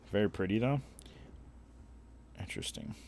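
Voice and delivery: ordinary delivery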